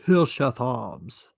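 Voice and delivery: slow, exaggerated fake British accent; badly faked British, dropping the Rs; terrible British accent. Shown as a demonstration. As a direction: draw it out in a hammy British accent. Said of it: "That's a terrible British accent".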